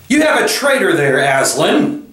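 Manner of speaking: high, accusing witch voice; imperious witch. A narrator's reading. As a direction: accuse him as the haughty witch.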